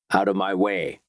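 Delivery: usual speaking voice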